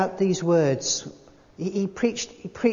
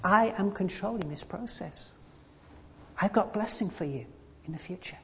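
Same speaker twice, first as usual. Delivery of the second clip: softer, near-whispered comfort; God's gentle reassurance; 11 dB quieter than usual. Pretend. Preacher voices God calming the anxious, hushed and tender. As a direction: hush them like God calming a worried heart. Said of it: "God says".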